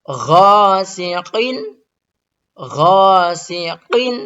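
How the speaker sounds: "Ghasiqin" drawn out slowly, high and loud; slow Quran recitation. Shown as a demonstration. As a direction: recite it slowly with a clear tanwin. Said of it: "Contoh yang tanwin". (bacaan tanwin jelas yang lambat)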